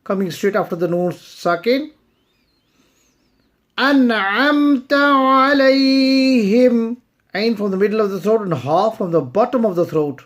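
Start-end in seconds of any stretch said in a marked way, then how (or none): 3.8-7.0 melodic tajweed recitation, a touch higher; Quran recitation. Acted. Teacher reciting the verses for students, melodic and measured. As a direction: chant the verses slowly and evenly.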